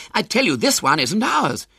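put-on high-pitched, emphatic boy insisting; vehement protest. A narrator's reading. Henry insisting the baby isn't theirs, vehement protest. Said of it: "protested Henry vehemently".

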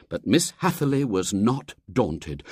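plain reading voice

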